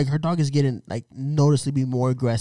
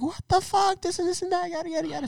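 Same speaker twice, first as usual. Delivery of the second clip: mocking girlfriend. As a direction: imitate his girl ranting. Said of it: "My girl was like".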